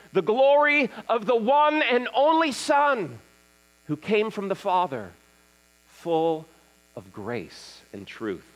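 solemn scripture reading. Acted. Pastor reading John to the standing congregation, solemn proclamation. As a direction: proclaim it in weighty phrases with pauses.

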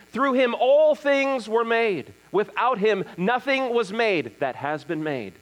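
slow, declaimed verses rising high, then settling; solemn scripture reading. Acted. Pastor reading John to the standing congregation, solemn proclamation. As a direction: proclaim it in weighty phrases with pauses.

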